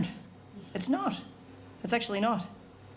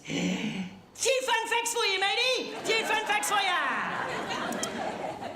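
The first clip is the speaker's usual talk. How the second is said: high, slow sing-song "Few fun facts for you"; hammed-up gloating, stretched out; mock smug taunt; 11 semitones higher, much slower than usual. Mocking the heckler's smug sign-off.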